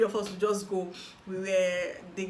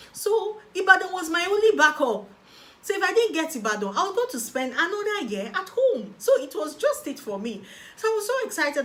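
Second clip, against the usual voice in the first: excited; 10 semitones higher, 8 dB louder than usual. Real, not acted. Stakes of her only backup.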